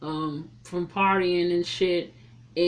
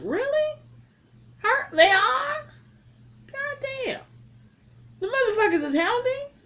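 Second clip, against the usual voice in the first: very high, squeaky incredulity; mock disbelief. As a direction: squeal it in hammed-up astonishment. Mock disbelief at clean vocal cords.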